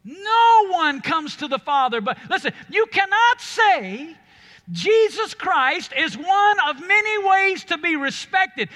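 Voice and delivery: loud, high, driving sermon delivery; fiery pulpit preaching to the congregation; full-force preaching. Acted. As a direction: preach it with everything, building to a roar.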